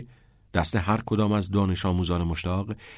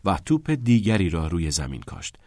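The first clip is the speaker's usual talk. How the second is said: usual speaking voice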